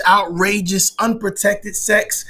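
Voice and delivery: normal talking voice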